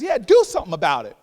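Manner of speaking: loud, punched comeback; emphatic retort. Retold or imitated. Elder woman answering his disbelief, loudly insistent. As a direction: fire it back with full force.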